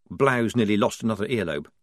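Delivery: ordinary delivery, nothing marked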